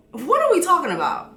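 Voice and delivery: ordinary delivery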